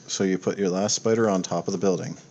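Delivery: ordinary talk, nothing marked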